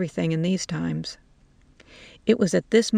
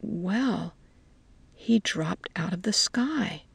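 softer, whispered aside; a dry, hushed answer; old woman whispering back to the soldiers; hushed reply; 5 dB quieter, slower than usual. A narrator's reading.

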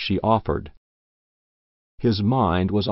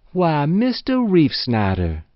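put-on old woman's higher, slower cry of surprise; surprised exclamation; 7 semitones higher, 3 dB louder than usual. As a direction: greet him with startled delight, drawn out. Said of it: "exclaimed old Matilda herself".

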